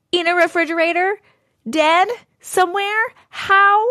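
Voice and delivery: can't believe what she's hearing; incredulous. Real, not acted. Reacting to a caller's creepy story, incredulous.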